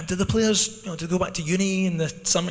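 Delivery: naive sponsor. Retold or imitated. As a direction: rattle it off like a clueless sponsor. Sponsor's naive question mimicked.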